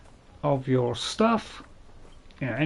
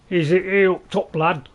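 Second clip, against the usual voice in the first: a fumbled first go at Northern English "up top, lad"; louder, stumbling Northern English demo; 5 semitones higher, 5 dB louder than usual. Shown as a demonstration. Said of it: "as we say in, uh, you know, in North England".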